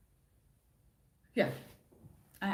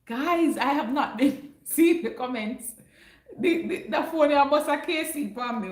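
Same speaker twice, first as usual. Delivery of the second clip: laughing apology; 7 semitones higher, 6 dB louder than usual. Real, not acted. Laughing apology about missed comments.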